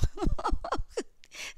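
a burst of high, excited giggling; real excited giggle. Real, not acted. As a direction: giggle high and bright with glee. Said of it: "I don't know why I'm giggling".

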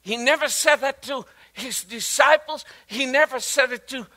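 loud, high, building sermon climax; fervent preaching. Acted. Preacher to the congregation, swelling to a fervent shout. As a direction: drive it home to the congregation with rising force.

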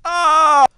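loud, high cry; real high yelp; startled yell. Real, not acted. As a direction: yell out in frustration at a near miss. Startled by game move.

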